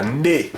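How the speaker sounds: Ndé with a prenasalised n, teacher demo; pronounce Ndé. Shown as a demonstration. Teacher demonstrating Ndé to students, prenasalised n before the D. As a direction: say Ndé as one syllable, nasal onset into D. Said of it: "cette prononciation-là".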